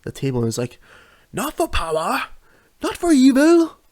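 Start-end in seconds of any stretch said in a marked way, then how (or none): 1.3-3.8 grand villain speech in a put-on accent, much higher, building louder; hammed-up movie-villain accent, swelling to the end; declaiming Bison's speech in a mock accent. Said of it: "I put on an accent for that".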